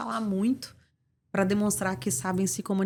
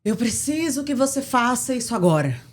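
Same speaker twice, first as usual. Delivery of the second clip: louder, emphatic order, word by word; forceful command; 6 dB louder than usual. Shown as a demonstration. Doctor confronting a nurse during the shift, forceful command. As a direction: press every word as a stern demand. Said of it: "Cada uma teve uma entonação".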